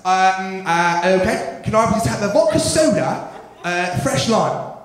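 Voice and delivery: put-on hesitant English-accented bar order; mock English customer accent. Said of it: "People from England".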